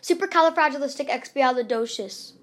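ordinary delivery

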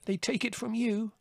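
put-on high, small young rabbit voice; sudden interjection. A narrator's reading. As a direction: cut in suddenly, far up in pitch. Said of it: "said Fiver suddenly".

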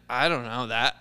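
normal talking voice

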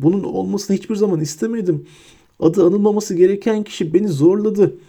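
high, wheedling rat-man voice; cringing Pettigrew plea. A narrator's reading. Pettigrew grovels to Hermione, high wheedling plea.

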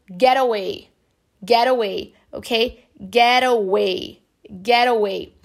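"get away" as "gera-way"; American flap T. Shown as a demonstration. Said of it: "Um americano". ("get away" com T americano soando como R)

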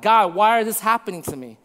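ordinary delivery

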